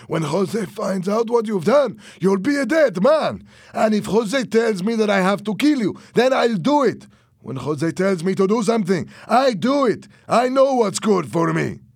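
high, loud, agitated warning; heated threat. A narrator's reading. Fernando threatens Louis in quarrel.

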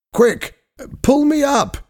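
urgent, very high-pitched cry for help; worried shout. A narrator's reading. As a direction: call up in alarm, voice leaping high. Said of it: "he shouted".

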